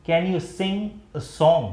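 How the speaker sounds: very slow and clear, each word apart; slow model sentence. Shown as a demonstration. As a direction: spell it out slowly for the class. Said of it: "हम लोग बोलेंगे सिंग". (बहुत धीमे, साफ़ उच्चारण)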